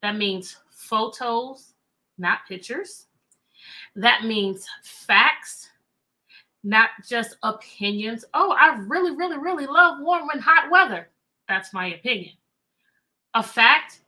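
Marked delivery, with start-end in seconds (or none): 0.0-8.2 slow, deliberate teacher stress on "photos" and "facts"; teacher emphasis. Shown as a demonstration. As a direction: spell out "photos, not pictures" and "facts, not just opinions" slowly like a lesson. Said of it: "me reading a nonfiction book".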